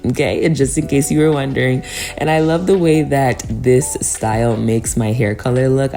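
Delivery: ordinary talk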